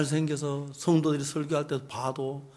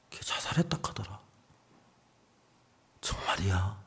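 hushed whisper; 10 semitones lower, 8 dB quieter than usual. Retold or imitated. Shocking news whispered.

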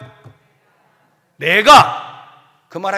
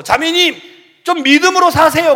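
mock pastor; 7 semitones higher, 6 dB louder than usual. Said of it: "교회 가서".

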